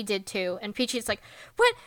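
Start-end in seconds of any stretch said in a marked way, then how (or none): 1.6-1.7 much higher, louder mock squeal; high mock-shocked squeal; scandalised friend. Peachy's scandalised reaction retold.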